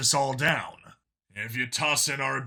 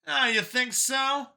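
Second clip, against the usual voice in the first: higher, lighter voice for Brian; Brian's voice; 11 semitones higher, slower than usual. A narrator's reading. Brian pushing back at Vincent, higher voiced. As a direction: voice the younger friend Brian, a bit higher. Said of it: "Brian answers Vincent".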